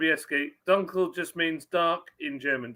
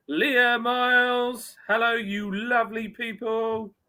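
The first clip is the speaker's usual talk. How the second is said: high, stretched-out greeting call; drawn-out shout-out; 8 semitones higher, 4 dB louder, slower than usual. Real, not acted. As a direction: announce the name high and long, like a welcome. Calls out greeting to chat member.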